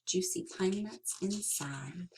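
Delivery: plain reading voice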